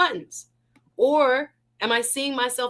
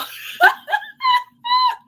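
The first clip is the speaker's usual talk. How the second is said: squealing laugh, much higher; high burst of laughter; 7 dB louder than usual. Real, not acted. Host laughing at a viewer's goodbye, high squealing laugh. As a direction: laugh out loud, delighted.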